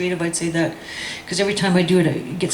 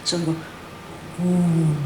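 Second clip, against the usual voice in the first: chanted call-and-response poem; spoken-word poet leading a crowd chant; poem performance; 2 semitones lower, 3 dB quieter than usual. Acted. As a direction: lead the room through the chant. Leads audience through chanted poem.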